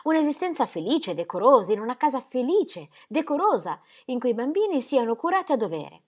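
voce femminile più acuta e agitata; Ingeborg implorante. Acted. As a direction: moglie che supplica con ansia. Ingeborg pleads with her husband. (voce alta e implorante di Ingeborg)

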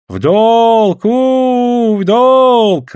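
high, loud whoop of joy; rejoicing. A narrator's reading. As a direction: cheer it out with drawn-out vowels. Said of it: "радовались бельговцы".

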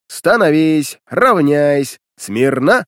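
loud clipped parade commands; shouting drill sergeant. Acted. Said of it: "громко крикнул".

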